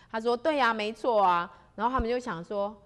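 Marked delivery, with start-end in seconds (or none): 0.4-1.5 emphatic agreement. Acted. 邱大仙肯定回答.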